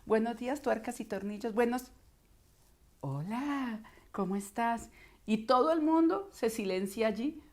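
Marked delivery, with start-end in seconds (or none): 0.0-1.9 higher, sing-song receptionist greeting; routine phone-greeting voice. Acted. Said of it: "uno oye esta voz todo el tiempo". 3.0-4.9 suddenly affectionate phone hello; smitten greeting. Acted. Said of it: "le llega muy al corazón".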